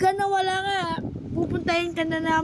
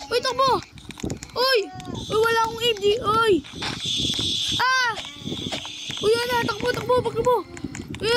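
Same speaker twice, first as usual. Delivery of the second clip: higher, urgent calls mid-chase; while running; 5 semitones higher than usual. Acted. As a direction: shout directions while fleeing. Fleeing in the game.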